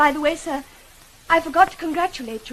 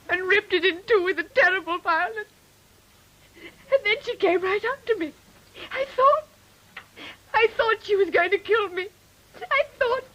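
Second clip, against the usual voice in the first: terrified sobbing; 5 semitones higher than usual. Acted. Jane tells Rochester of the woman in the night, sobbing in terror. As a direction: sob it out, reliving the terror.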